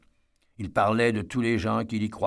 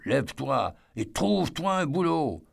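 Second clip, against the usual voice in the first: lightly voiced drunk yell, pitched higher; yelling again; 5 semitones higher than usual. A narrator's reading. Father yelling again at Eddie to get a job, mild slurred yell. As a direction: repeat the order with a drunk man's insistence. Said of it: "Son père hurla de nouveau".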